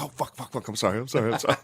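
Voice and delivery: retold frantic backpedal; mock panicked apology. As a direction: blurt it out, imitating a guilty son in panic. Retold panicked apology to crying mom.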